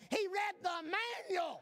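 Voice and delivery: high, laughing triumphant line; wife crowing the punchline with a laugh. Retold or imitated. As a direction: deliver it laughing, called out.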